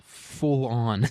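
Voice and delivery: usual speaking voice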